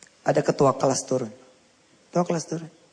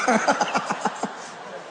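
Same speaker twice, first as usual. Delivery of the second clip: high, breath-filled burst of laughing; laughter; 7 semitones higher than usual. Real, not acted. Preacher amused by the child's car ride, laughing. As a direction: break into a laugh at your own joke. Laughs at own comic retelling.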